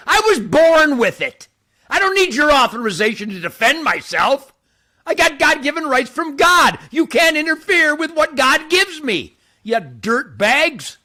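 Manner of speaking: high, loud, preaching fervour; impassioned shout. Real, not acted. Preaching gun rights to Texans, shouting high and loud. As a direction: cry it out like a sermon.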